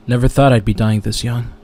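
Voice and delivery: normal talking voice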